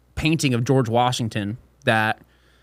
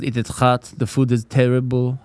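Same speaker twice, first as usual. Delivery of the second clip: put-on French Canadian accent. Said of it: "all from fucking Quebec".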